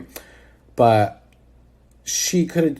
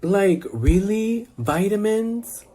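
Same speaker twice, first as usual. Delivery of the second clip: slow disbelief; 6 semitones higher, slower than usual. Real, not acted. Disbelief at the vitamin feud.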